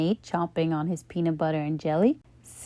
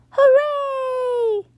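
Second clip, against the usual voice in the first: much higher excited cheer; 3 dB louder than usual. A narrator's reading. Class hearing about the picnic, cheering. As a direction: shout for joy. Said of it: "everyone shouted".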